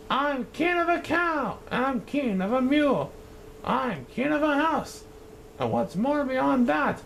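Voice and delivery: high, pompous proclamation; gloating king. Acted. Yertle gloating from his turtle stack, high and pompous. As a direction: crow over everything you rule.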